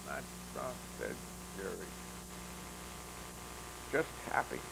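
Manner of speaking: soft, far-off, breathy words; soft reply. A narrator's reading. Yuri answering Hope softly, hushed. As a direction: say it hushed and content.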